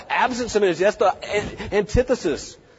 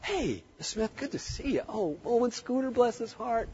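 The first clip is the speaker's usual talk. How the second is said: softer, easy, friendly hello; quiet friendly greeting; 7 semitones higher, 9 dB quieter than usual. Shown as a demonstration. Vet greeting a client and her dog softly and kindly. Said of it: "I can do this all the time".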